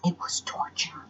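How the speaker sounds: whispered, hushed; dramatic whisper. A narrator's reading. Telling the dog story, a hushed dramatic whisper. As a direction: breathe it like a secret.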